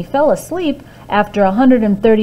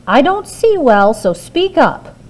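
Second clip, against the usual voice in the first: higher, louder half-blind giantess; 5 semitones higher, 5 dB louder than usual. A narrator's reading. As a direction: demand he speak up. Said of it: "she said".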